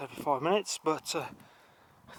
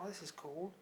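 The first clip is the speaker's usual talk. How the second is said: ordinary talk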